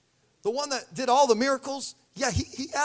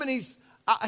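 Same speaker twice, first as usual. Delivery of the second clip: normal talking voice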